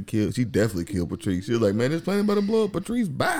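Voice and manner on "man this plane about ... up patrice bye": mock wife ditching. Wife ditching husband on plane.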